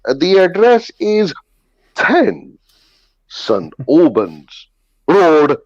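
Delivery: mock-proper phone voice, word by word; mum's careful English. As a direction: pronounce each word painstakingly, then botch "Road". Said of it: "And she'd be going".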